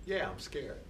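quiet voice late at night admitting fear; late-night inner voice. Pretend. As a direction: confess it softly in the dark. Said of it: "A voice really late at night says".

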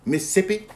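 "Mississippi?" squeezed to "Missippi", rising question; Southern Mississippi. Shown as a demonstration. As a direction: Mississippi with the middle syllable dropped, asked back. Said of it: "See how I said Mississippi?".

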